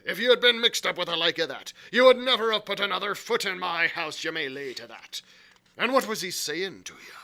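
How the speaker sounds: loud, stern warning; exclaiming. A narrator's reading. Landlord warning Morgan off bad company, exclaiming. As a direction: lay down the law loudly. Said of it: "exclaimed the landlord".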